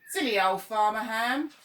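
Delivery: laughing crows taunting the farmer; loud sing-song jeer; jeering shout. Acted.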